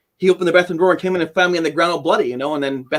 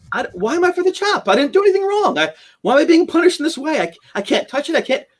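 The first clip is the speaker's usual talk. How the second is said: anguish; 11 semitones higher than usual. Retold or imitated. Despairing at his wounds in bathroom.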